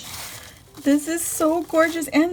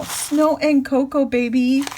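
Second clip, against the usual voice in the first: ordinary talk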